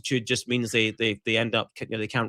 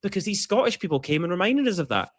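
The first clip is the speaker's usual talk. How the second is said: a lightly voiced, higher gushing American fan; 7 semitones higher than usual. Mocking grateful American fans for co-hosts, high gushing voice. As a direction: gush like a grateful fan, pitched up. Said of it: "everyone's like".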